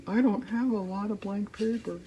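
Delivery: a goofy dopey put-on voice. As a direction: say it like a dopey cartoon character. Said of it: "that's how I talk".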